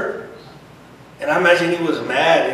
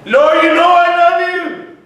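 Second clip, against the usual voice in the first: loud, much higher exasperated cry; mad reply; 12 semitones higher, 9 dB louder than usual. Retold or imitated. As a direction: fling it back hurt and fed up. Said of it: "Peter got mad again".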